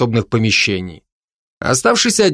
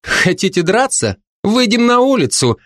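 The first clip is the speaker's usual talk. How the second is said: coolly cutting in, pitched up; cold challenge; 9 semitones higher than usual. A narrator's reading. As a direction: challenge him to step outside. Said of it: "холодно перебил его молодой бард". (холодный вызов)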